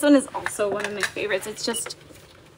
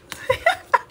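high, airy real laugh; helpless high-pitched laughing; 14 semitones higher than usual. Real, not acted. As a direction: burst into a breathless high giggle. Laughing at a viewer's comment.

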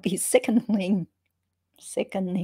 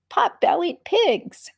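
high, loud, slow squeal of delight; 10 semitones higher, 4 dB louder, slower than usual. A narrator's reading. Narrator squealing with delight at the pot-bellied pigs. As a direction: squeal it with glee.

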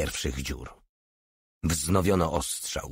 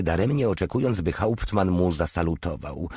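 usual speaking voice

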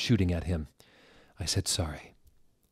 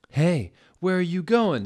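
high, louder call after her; 10 semitones higher, 6 dB louder than usual. A narrator's reading. Boy calling after girl falling down a hole.

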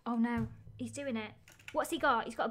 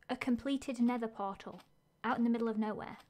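ordinary talk

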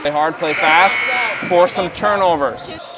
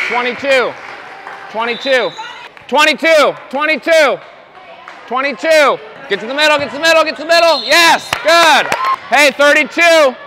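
sideline shouting; 5 semitones higher than usual. Real, not acted. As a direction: call the plays out over the crowd. Coach yelling the defense call.

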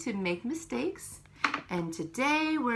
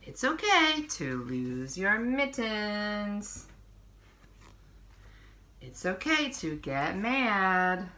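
put-on reading to children. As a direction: read each page to little ones, unhurried. Reads picture book to children.

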